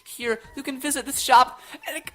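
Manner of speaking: high, loud, giddy laugh through the words; bubbling over, laughing as you read; overjoyed gamer laughing at the news; laughing with excitement. Real, not acted. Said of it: "this is the most excited I've been".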